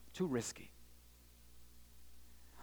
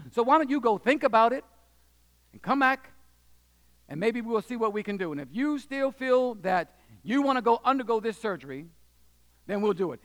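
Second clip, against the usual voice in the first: put-on louder, fast, blunt old surgeon brushing it off; gruff doctor's warning; 5 semitones higher, 5 dB louder than usual. Surgeon warning the parents and boy, louder and brusque. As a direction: bark the doctor's impatience. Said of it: "So he says".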